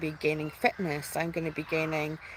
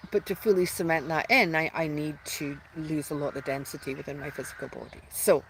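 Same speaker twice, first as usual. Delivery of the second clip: ordinary talk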